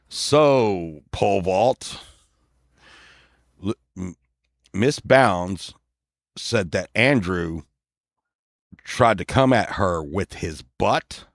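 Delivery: low, slow, halting cop voice; mock-serious low lawman; police officer. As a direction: question solemnly, with long pauses, like a policeman. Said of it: "and they were like".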